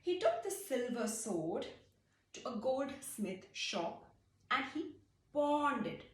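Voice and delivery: ordinary delivery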